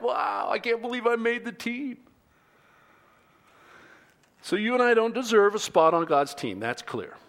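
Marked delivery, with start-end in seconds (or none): 0.0-2.0 high, astonished voice; delighted disbelief; amazed exclamation. Retold or imitated. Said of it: "Paul was like".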